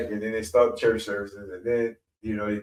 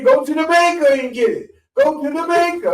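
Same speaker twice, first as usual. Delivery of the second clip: much higher, louder scolding; preaching shout; 8 dB louder than usual. Retold or imitated. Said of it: "I'm gonna tell you".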